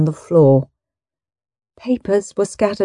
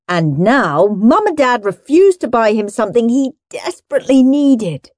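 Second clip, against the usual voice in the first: plain reading voice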